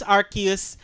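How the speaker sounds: Arceus with a K sound; hard-C pronunciation. Shown as a demonstration. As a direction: pronounce Arceus "AR-kee-us". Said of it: "I'll just use Arceus".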